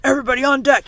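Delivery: loud command yell; cried out. A narrator's reading. Captain calling the crew up on deck, loud cry. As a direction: bellow the order over the storm. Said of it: "he cried".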